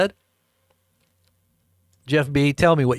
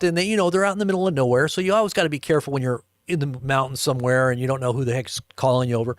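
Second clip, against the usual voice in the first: ordinary talk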